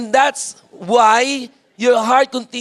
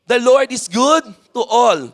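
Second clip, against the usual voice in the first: raised, emphatic read-aloud for all to join; leading group reading; 3 semitones higher, 3 dB louder than usual. Shown as a demonstration. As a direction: lead the congregation in reading the verse. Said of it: "let us all read, one, two, three, go".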